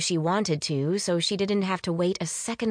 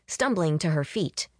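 ordinary delivery, nothing marked

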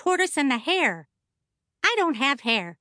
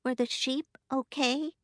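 put-on soft, shy little girl voice; much softer, a timid child asking; 7 dB quieter than usual. A narrator's reading. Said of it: "a very shy third grader".

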